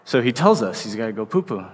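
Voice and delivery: ordinary delivery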